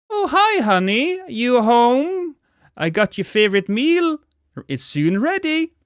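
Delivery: high, loud cheery mother calling out; overly cheerful mother greeting her child; chipper sing-song mom. Acted. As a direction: sing it out like a too-sweet mom. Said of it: "your mother's sing-song voice. She l-, sounds really chipper".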